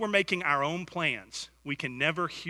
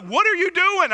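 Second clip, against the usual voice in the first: high, loud mock outrage; imitating wife; 14 semitones higher, 9 dB louder than usual. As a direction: squeak it like an indignant wife. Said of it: "And she's like".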